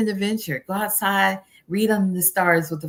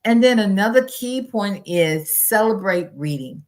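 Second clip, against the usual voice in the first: ordinary talk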